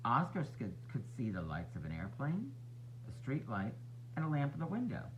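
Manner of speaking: usual speaking voice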